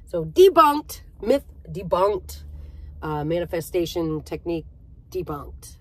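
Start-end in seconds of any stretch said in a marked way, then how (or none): 0.2-2.4 higher and louder, emphatic; proud loud verdict; loud declaration. Real, not acted. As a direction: announce it triumphantly. Declaring myth debunked.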